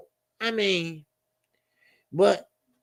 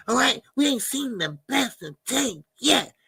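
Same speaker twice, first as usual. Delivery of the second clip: ordinary talk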